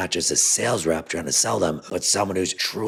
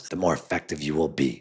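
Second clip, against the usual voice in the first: usual speaking voice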